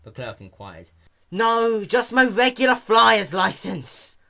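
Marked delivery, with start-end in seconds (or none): 1.3-4.2 high, louder, slower impatient answer; barely holding it together; strained impatient reply; irritated reply. Acted. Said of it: "Dash replied".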